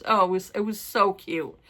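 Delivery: normal talking voice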